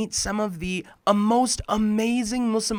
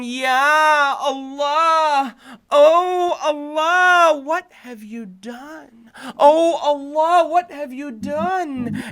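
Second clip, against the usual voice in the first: high, slow anguished wail with a sob; wailing cry to Allah; 9 semitones higher, 6 dB louder, much slower than usual. Acted. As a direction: cry out to God in pain, drawing every word out. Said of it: "He was yelling at the top of his lungs".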